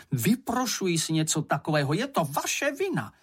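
indignant complaint climbing very high; outraged protest without real volume; yelling. A narrator's reading. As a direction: accuse them, pitch shooting up at the end. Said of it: "křičela Ondatra".

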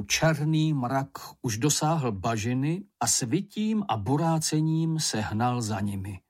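ordinary delivery

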